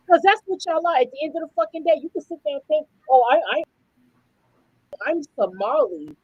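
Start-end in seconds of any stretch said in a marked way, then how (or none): 3.1-6.1 lower, sneering mock quote of a proud voter; a mocking lowered put-on voice; mock Somali voter. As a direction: drop the voice low and mock their claim.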